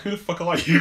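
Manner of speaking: a retold rude brush-off, half-acted; gruff retort. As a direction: snap it back like a grumpy old man. Old [name] rebuffs stranger.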